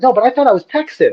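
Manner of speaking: father mimics his son insisting he's only Texan; imitating his young son. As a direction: play the little boy who's sure he's Texan. Father imitates son's protest.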